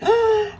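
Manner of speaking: gasp. Acted. Gasp as baby keeps crying.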